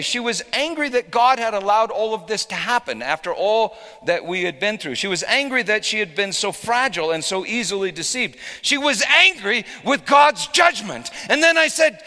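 high, loud fiery pulpit voice; preaching at full force. Acted. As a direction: thunder it over the congregation.

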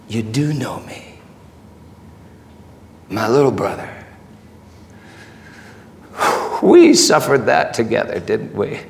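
low, hushed, intimate voice; tender comfort. Acted. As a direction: speak softly to a little brother, with long pauses. Jesus gently comforts Peter.

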